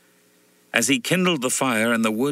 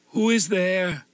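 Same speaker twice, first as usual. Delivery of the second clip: high, fearful sick woman calling through the door; a scared widow's voice, pitched up; frightened widow at night, high and uneasy; 9 semitones higher, slower than usual. A narrator's reading. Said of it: "asks a frightened voice".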